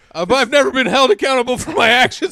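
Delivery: high, loud, fast whiny rant; entitled rich guy. Retold or imitated. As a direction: whine like a spoiled rich man. Mocking entitled rich men.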